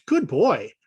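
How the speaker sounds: praising a puppy. Retold or imitated. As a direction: reward the puppy with your voice.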